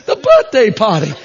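put-on BIRTHDAY PARTY with dropped r's, Northeast accent. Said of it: "heavy accented women".

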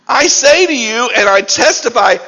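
loud, forceful scripture quote; emphatic. Acted. Preacher quoting Paul to the church, emphatic. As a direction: declare it like solemn testimony. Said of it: "I want you to hear the emphasis".

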